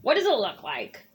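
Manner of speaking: low, sulky bird voice; grumpy bird. Acted. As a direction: answer like a sulking bird.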